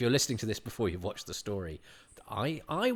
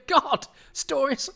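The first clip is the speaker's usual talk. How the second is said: incredulous; 6 dB louder, slower than usual. Retold or imitated. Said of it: "And I'd go".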